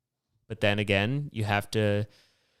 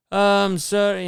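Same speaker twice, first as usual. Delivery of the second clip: loud, high-pitched Irishman; Irish accent; 10 semitones higher, 7 dB louder than usual. Retold or imitated. Drunk man leaning down to a stranger, loud Irish accent. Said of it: "he had an Irish accent".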